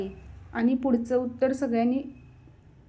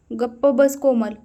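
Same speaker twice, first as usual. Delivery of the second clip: ordinary delivery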